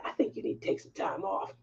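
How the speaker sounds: soft whispered, quick advice; a coworker quietly telling her to take time off; hushed quote. Retold or imitated. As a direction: murmur it gently, almost under your breath. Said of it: "she was just like".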